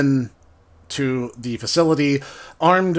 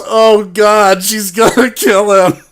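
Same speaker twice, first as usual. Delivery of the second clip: louder, higher mock gasp of alarm; 6 semitones higher, 6 dB louder than usual. Players in chat reacting to the date, louder mock alarm. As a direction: chatters groaning over a doomed character. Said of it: "everyone else in the chat just goes".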